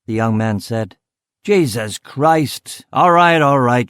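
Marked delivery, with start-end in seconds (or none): 1.4-3.9 higher, drawn-out curse into loud concession; exasperated. Acted. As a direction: give in loudly and impatiently. Exasperated boss during fire alarm.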